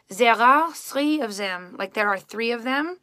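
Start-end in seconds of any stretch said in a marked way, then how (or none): 0.1-1.7 slow Parisian French accent, th softened to z; French-from-Paris accent with buzzy z for th. Shown as a demonstration. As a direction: demonstrate English as a Parisian says it, drawn out. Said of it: "Paris, you would say".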